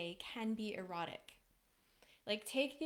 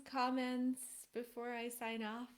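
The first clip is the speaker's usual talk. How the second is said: ordinary talk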